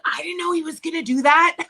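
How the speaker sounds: mock dad caught off guard; taken aback. Daughter retelling her dad's reaction, taken aback.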